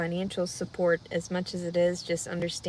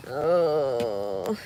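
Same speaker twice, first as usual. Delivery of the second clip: whimper; 8 semitones lower than usual. Real, not acted. Fearful cry.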